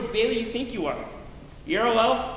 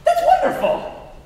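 much higher, laughing with glee; delighted; 6 dB louder than usual. Acted. The angel laughing with delight at George's wish. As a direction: burst out with joy at the idea.

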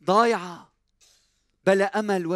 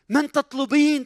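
raised challenge; 8 semitones higher, 5 dB louder than usual. Acted. Same question louder.

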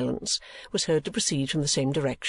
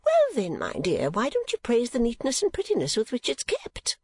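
high, fussy mother voice; Mrs. Nickleby; 9 semitones higher than usual. Acted. Mother asking why her daughter won't praise, high and reproachful. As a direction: chide in a high, swooping voice.

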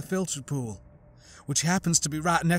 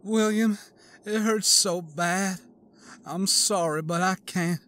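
pained plea; 5 semitones higher than usual. A narrator's reading. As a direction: plead through the pain, close to giving up. Annette in pain, apologizing.

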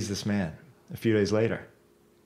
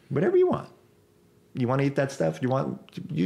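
high mock carefree; 5 semitones higher than usual. Voicing own letting go.

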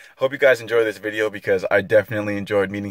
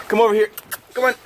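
coaxing a wild bird; 9 semitones higher, much faster than usual. Real, not acted. Calling the bird over.

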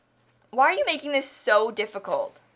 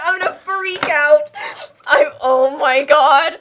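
loud frustrated yell; freaking out; 3 semitones higher, 9 dB louder, slower than usual. Real, not acted. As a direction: shout it out in exasperation. Fish frustration boils over.

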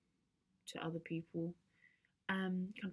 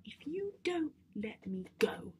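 higher, voiced emphatic warning; insistent threat; 8 semitones higher, slower than usual. Teen warning Dad to let her go, insistent. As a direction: press the warning out word by word. Threat repeated more forcefully.

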